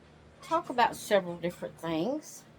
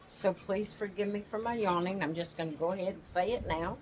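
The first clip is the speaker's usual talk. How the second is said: ordinary talk, nothing marked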